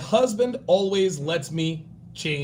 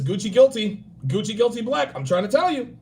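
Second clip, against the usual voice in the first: normal talking voice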